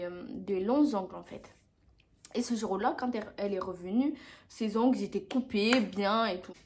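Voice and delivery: usual speaking voice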